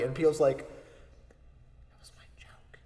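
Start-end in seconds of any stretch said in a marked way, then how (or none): 1.9-2.8 soft hushed whisper. Retold or imitated. Peele whispers his complaint to Key, hushed. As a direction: lean over and whisper it.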